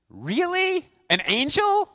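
high-pitched, disbelieving question; comic, high disbelief; incredulous. Real, not acted. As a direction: shoot the pitch right up in mock astonishment. Incredulous reaction to angel explanation.

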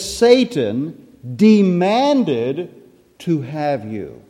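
scripture drawn out slowly, pause before the last words; very slow, deliberate verse reading; slow emphatic quote. Acted. Reads verse with emphasis, then restates.

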